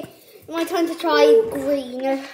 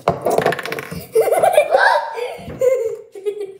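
laughing; 6 semitones higher than usual. Real, not acted. Reacting to sour spray.

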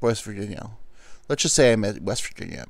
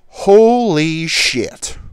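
amazed; 6 semitones higher, 10 dB louder, much slower than usual. Retold or imitated. Kid amazed reaching second.

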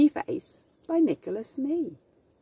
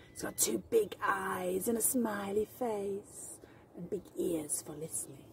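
ordinary delivery

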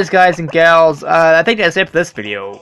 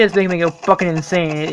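ordinary delivery

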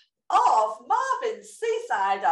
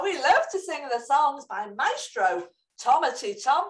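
usual speaking voice

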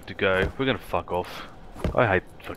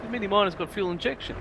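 much higher, taunting voice; high taunting retold bloke; cocky Mini bloke; 11 semitones higher than usual. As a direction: needle him from up high. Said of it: "He goes".

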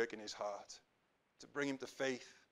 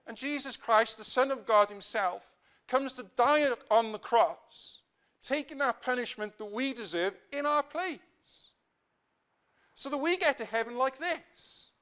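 raised, louder preacher's proclamation; impassioned preaching; 8 semitones higher, 6 dB louder than usual. Real, not acted. Preacher telling congregation why Christ died, impassioned preaching. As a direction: preach it out, high and strong.

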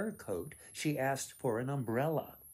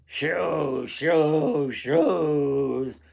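loud goblin echo; 3 semitones higher, 11 dB louder, much slower than usual. Acted. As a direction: goblins calling back as they run off. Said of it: "echoed the goblins".

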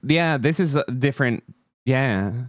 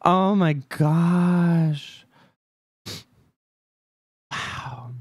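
slow, drawn-out disbelief; awed; 4 semitones higher, much slower than usual. Real, not acted. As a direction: stretch it out in awe. Game finished, in awe.

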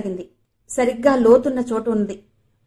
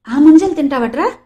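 louder, higher, recoiling in disgust; 5 semitones higher, 8 dB louder than usual. A narrator's reading. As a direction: ask it as if the food turns your stomach. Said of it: "కంపరంగా అడిగాడు వాసు". (అసహ్యంగా, గట్టిగా అడగడం)

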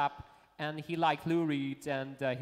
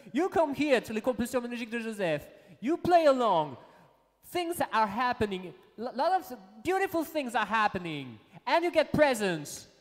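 loud, higher-pitched showman's pitch; exuberant hype; 7 semitones higher, 7 dB louder than usual. Acted. Host telling the guest what the show gives, loud exuberant hype. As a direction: proclaim each phrase at high volume.

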